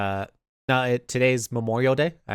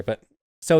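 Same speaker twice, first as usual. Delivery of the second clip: ordinary talk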